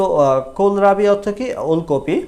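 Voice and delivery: ordinary talk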